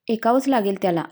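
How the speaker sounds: usual speaking voice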